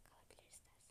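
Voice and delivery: soft unvoiced whisper. Real, not acted. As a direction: whisper it under your breath. Whispers while choosing outfit.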